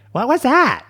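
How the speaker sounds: high, loud mock squawk; exaggerated high scolding impression; imitating partner's outburst. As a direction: mimic a loud, high-pitched demand. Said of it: "I don't wanna hear you be like".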